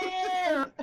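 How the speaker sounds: high, alarmed wail; freaking out. Acted. Frantic woman hears mention of siren, high panicked cry. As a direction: stretch it out in acted panic. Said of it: "freaking out".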